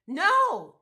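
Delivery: a sudden loud high no; inner shout. Retold or imitated. Her inner voice shouting no at the gym, loud and high. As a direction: blurt out no from the gut.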